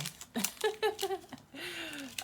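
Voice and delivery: high, drawn-out laugh; emotional laugh. Real, not acted. Reader fighting tears over her favorite, high emotional laugh.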